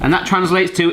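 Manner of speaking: ordinary delivery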